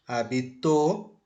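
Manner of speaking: "habitó" slow, ending on a stressed TÓ; stress on last syllable. Shown as a demonstration. Teacher gives a past-tense example sentence, stress on final syllable. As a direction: draw out "habitó" with weight on the final Ó. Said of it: "A sílaba tônica agora está no, na última sílaba".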